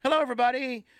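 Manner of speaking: high, fast, overly sweet family greeting; mock normal guy. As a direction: play the model son at Thanksgiving. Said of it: "like, real normal".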